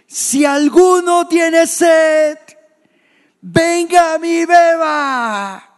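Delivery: loud, high, drawn-out cry to a crowd; voz alzada. Acted. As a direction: shout it slowly, every word sustained at full force. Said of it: "y alzó la voz". (grito fuerte, alto y sostenido)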